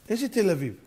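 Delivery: ordinary delivery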